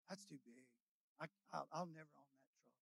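very low, hushed self-talk; quiet doubting thought. Acted. Preacher voices a doubter seeing a truck, very low and hushed. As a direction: drop right down, as if thinking it privately.

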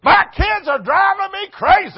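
high, loud whiny shout; hammed-up shouted complaint; mock complaint shout. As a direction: yell it like an exasperated parent. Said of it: "That's how people talk".

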